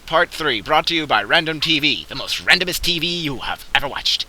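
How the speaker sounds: booming high trailer announcer; loud, high mock movie trailer voice. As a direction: hype it like a cinema promo.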